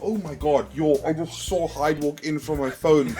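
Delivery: ordinary delivery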